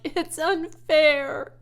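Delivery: loud, high, slow, tearful cry; child's tearful outburst; sobbing protest. A narrator's reading. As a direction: wail it through tears. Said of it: "she sobbed".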